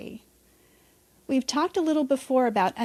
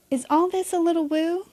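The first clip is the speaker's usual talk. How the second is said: higher teasing rhetorical question; playful; 6 semitones higher than usual. Real, not acted. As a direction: tease the listener, playfully.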